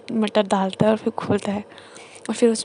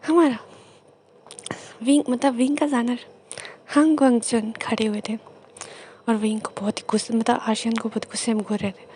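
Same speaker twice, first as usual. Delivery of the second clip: usual speaking voice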